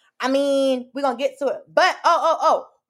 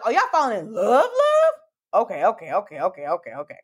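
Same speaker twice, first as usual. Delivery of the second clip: mock astonishment; 2 semitones lower than usual. Reacting to a drama couple falling in love, mock shock. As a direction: play up the shock, then settle into amused okays.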